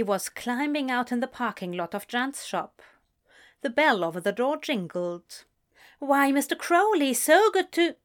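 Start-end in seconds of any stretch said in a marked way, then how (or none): 6.0-8.0 higher, louder bright greeting; cheery shopkeeper. Acted. Shopkeeper Jan greets Crowley, high and cheery. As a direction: welcome him in sweetly.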